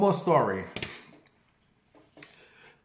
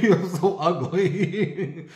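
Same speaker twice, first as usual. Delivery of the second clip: laughing through the words, slowed; 3 semitones higher, much slower than usual. Real, not acted. Joking to viewers that nobody likes her, laughing. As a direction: giggle at her own joke.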